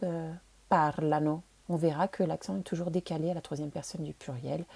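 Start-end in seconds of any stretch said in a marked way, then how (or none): 0.7-1.4 "parlano" slow, with the stress on PAR; stress on first syllable. Shown as a demonstration. Teacher showing learners the accent, "parlano" stressed on PAR. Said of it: "L'accent va être décalé sur la première syllabe".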